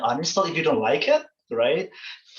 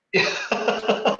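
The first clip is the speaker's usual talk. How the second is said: short higher-pitched chuckle; amused laugh; 9 semitones higher than usual. Real, not acted. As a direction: laugh lightly at your own guess. Laughs at own accent guess.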